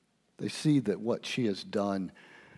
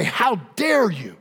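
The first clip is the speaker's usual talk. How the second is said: indignant rebuke; 11 semitones higher, 11 dB louder, slower than usual. Retold or imitated. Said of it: "and they say".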